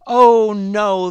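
loud. Acted. Frog refuses the others.

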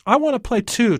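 put-on high, loud kid pleading to join; eager little boy. Tommy begs the older kids to let him play, eager and high. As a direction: pipe up like an excited child.

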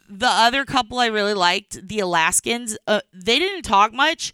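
ordinary talk, nothing marked

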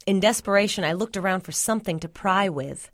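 ordinary delivery

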